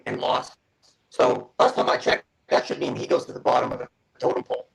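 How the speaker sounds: robotic, glitchy connection voice; robot voice over a breaking line. Real, not acted. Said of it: "you sound like a robot right now".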